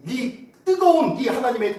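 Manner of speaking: ordinary talk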